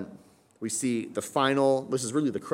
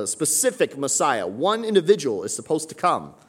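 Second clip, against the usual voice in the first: loud, raised preaching voice; fervent preaching; 3 semitones higher, 6 dB louder than usual. Acted. Pastor preaches joy to the congregation, loud and fervent. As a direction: build up the congregation's excitement.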